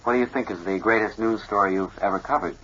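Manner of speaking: ordinary talk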